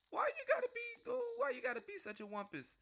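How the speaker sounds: whiny complaint. Real, not acted. Game opponent chasing him.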